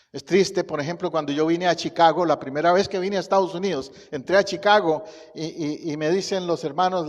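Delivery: ordinary talk, nothing marked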